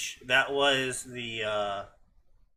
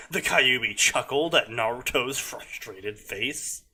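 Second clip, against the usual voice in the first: ordinary talk, nothing marked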